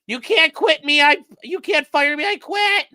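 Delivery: shrill hammed-up huff; mocking impression. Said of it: "Oh, yeah, I'm sure".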